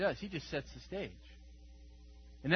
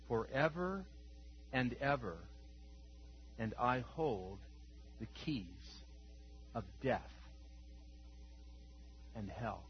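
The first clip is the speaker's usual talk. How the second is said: solemn scripture reading; 5 semitones lower, 9 dB quieter than usual. Acted. Preacher reading Christ's words to John, quiet and solemn. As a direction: hold each phrase and let it sit in silence.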